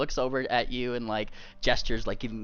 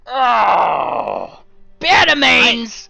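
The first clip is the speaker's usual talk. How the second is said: husband outraged at the guests; outraged shout; 11 semitones higher, 14 dB louder than usual. Acted. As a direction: bellow the name in disgust. Dragon outraged exclamation.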